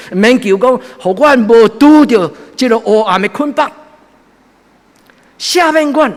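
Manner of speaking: high, loud impassioned sermon; fervent preaching. Acted. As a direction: preach it with full fire. Preaching at full force.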